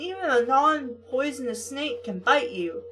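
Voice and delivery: normal talking voice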